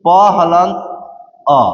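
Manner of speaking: क हलंत, pause, then अ on its own; broken into parts. Shown as a demonstration. Teacher breaking क into consonant and vowel, pausing between parts. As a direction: split क हलंत and अ apart with a clear pause. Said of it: "इसको हम तोड़ेंगे".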